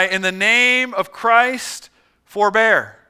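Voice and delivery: loud, slow, drawn-out command; yelled plea. Acted. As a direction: yell to stop the fighters. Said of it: "he yelled with his hands high".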